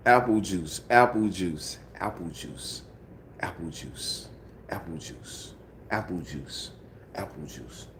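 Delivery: apple juice with juice as zhoos, fading; repeated apple juice. Shown as a demonstration. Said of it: "I ain't saying it correct".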